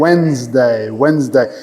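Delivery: Wednesday said as WENZ-day, no D; silent D in Wednesday. Shown as a demonstration. Teacher correcting a student's Wednesday, silent-D pronunciation demo. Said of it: "não falo de".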